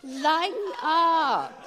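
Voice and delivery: high and slow, drawn out through a laugh; the angel teasing them into laughter. Retold or imitated. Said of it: "he's saying".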